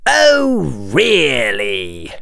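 drawn-out annoyed "oh, really". A narrator's reading. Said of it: "in an annoyed tone".

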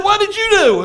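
loud, high confrontation; stern demand. Acted. God confronting Eve after the fall, stern and loud. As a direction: call her to account at full voice.

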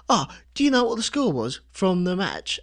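mock Cockney. Acting out a Cockney asking the match score. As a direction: ask it like a Cockney punter. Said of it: "let's do it in the Cockney accent".

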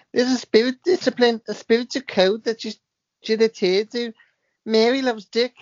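high, loud spirit-medium voice, second try; channelled spirit voice. Pretend. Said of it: "I'm gonna start this one again".

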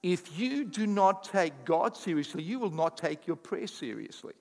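normal talking voice

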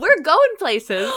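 high, loud, slow excited. Real, not acted. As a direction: drag it out gleefully. Excited joke.

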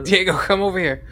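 loud, excited call to a friend; rally your buddy, loud and high. Real, not acted. Rallying teammates.

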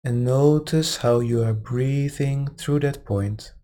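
calm, unhurried meditation guide; guided meditation. Acted. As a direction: ease them gently into relaxation. Meditation guidance resumes after aside.

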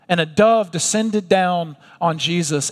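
ordinary delivery

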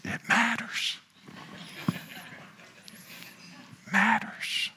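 hushed, high near-whisper; dialed back. Real, not acted. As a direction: pull the volume right down but keep the emphasis. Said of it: "dial that back a little".

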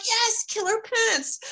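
very high, gleeful agreement; acted-out eager squeal; excited. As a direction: jump on the silly idea with delight. Friends acting out their excited brainwave.

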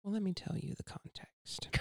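breathy dramatic whisper. Shown as a demonstration. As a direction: lean in and whisper it. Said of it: "I got my radio voice".

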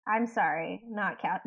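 ordinary talk, nothing marked

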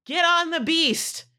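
high-pitched rallying call, slowing at the end; mock shout to the group; pretend yell. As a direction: call everyone over urgently without full volume. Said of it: "yelling and I'm like".

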